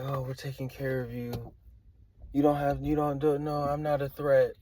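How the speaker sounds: imitating a sly, soothing promise, starting hushed; sneaky fake reassurance. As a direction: pretend you're no threat, softly at first. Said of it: "They gotta do it like this".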